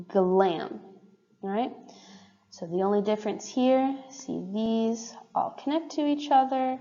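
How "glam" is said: "glam" said as a model word with voiced g; "glam", clearly voiced opening g. Shown as a demonstration. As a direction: demonstrate the g at the start of "glam". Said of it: "the k and the g".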